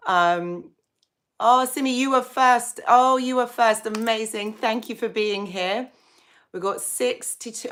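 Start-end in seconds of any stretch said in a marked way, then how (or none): none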